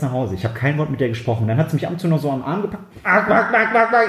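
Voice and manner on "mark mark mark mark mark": louder, higher insistent calling of Mark. Retold or imitated. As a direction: pester him by calling Mark over and over. Sister tugging his arm, calling.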